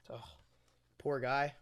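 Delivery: usual speaking voice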